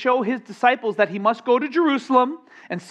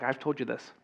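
muttered exasperation; 7 semitones lower, 11 dB quieter, faster than usual. Retold or imitated. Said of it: "I was like".

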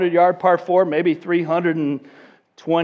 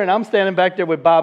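ordinary talk, nothing marked

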